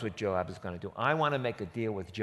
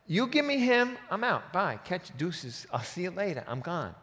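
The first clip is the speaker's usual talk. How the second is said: higher, breezy slangy brush-off; casual streetwise; 6 semitones higher than usual. Retold or imitated. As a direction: toss it off like a quick exit. Joab's casual slang paraphrase.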